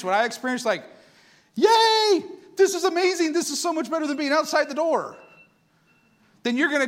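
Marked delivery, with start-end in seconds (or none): 1.6-5.2 very high, loud delighted yay; joyful cheer. Pretend. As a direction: burst out with pure joy. Imagined joyful believer.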